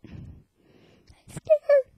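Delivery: very high scared squeak. Pretend. Scared of ghost.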